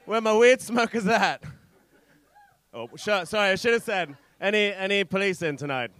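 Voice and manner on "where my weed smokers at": loud, raised crowd call. Real, not acted. Comic rallying the weed smokers, hyped call. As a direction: holler it out to the room.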